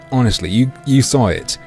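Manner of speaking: normal talking voice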